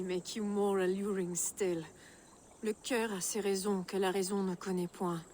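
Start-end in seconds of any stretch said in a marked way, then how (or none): 2.6-5.2 quick, lilting line in native French; accent français, rapide et charmeur. Acted. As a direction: purr it in French to charm him. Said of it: "It's the French accent".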